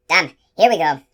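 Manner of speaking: ordinary delivery, nothing marked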